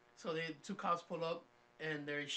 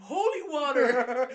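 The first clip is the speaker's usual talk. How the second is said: louder, slow, laughing through the words; 6 semitones higher, 13 dB louder, much slower than usual. Retold or imitated. Retelling answering the cops at church, laughing slowly. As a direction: crack up as you say it. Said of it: "He's like".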